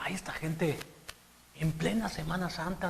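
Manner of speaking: hushed, disapproving whisper; gossiping whisper. Retold or imitated. Señora complaining about Holy Week drinkers, whispering. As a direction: whisper it like a scandalized churchgoer. Retelling a churchgoing lady's complaint.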